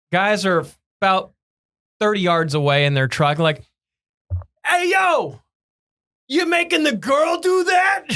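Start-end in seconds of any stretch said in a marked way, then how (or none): 4.6-5.4 yelling from across the lot; heckling shout from a truck. Retold or imitated. 6.3-8.0 high heckling shout. Retold or imitated. First telling of the heckle.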